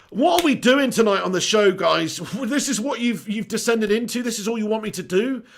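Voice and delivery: ordinary talk, nothing marked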